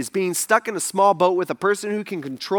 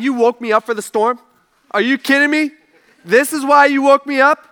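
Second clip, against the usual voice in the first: raised, louder disbelief, building; incredulous; 5 semitones higher, 5 dB louder than usual. Retold or imitated. Jesus to the disciples who woke him for a storm, incredulous. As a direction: ask as if it's absurd to be woken.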